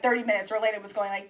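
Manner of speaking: usual speaking voice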